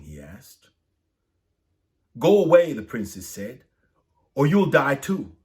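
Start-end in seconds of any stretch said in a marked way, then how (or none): 2.2-2.7 high, loud cry to go away; urgent warning. Acted. Frightened princess warns the soldier. 4.3-5.4 high, loud cry to go away; urgent warning. Acted. Frightened princess warns the soldier.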